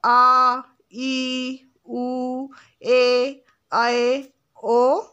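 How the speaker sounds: each long vowel drawn out clearly. Shown as a demonstration. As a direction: stretch every vowel to double length. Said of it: "कौन कौन से थे ये?". (दीर्घ स्वर खींचकर)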